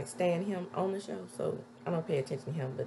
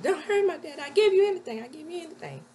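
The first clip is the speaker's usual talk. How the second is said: begging; 12 semitones higher, 7 dB louder, faster than usual. Retold or imitated. Daughter begs him not to hurt her dad, imitated pleading. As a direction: imitate a desperate plea, pitched way up. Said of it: "she's begging him not to".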